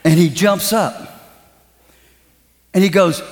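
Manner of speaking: ordinary delivery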